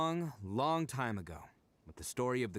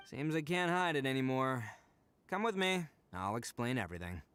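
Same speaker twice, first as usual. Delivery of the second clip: ordinary talk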